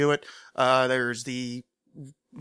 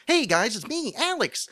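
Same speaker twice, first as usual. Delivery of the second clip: chipper host greeting; 11 semitones higher than usual. Retold or imitated. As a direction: pop the greeting up high like an eager vlogger.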